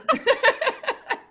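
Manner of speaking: high, loud burst of laughter; big laugh. Real, not acted. Host laughs hard at her own sales joke, big laugh. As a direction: let a real laugh break out.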